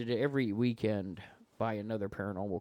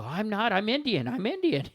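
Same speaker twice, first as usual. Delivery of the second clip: panicked Indian caller; 10 semitones higher, 4 dB louder than usual. Retold or imitated. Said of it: "some Indian fellow one time".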